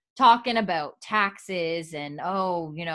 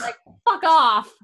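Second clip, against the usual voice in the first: loud, higher, strained fuck off; exasperated outburst; 9 semitones higher, 9 dB louder than usual. Real, not acted. Fan reacting to a snobbish dinner guest, loud outburst. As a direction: blurt it out in real frustration.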